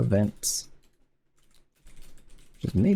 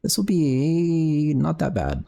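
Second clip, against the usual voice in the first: high sing-song; 6 semitones higher, slower than usual. Pretend.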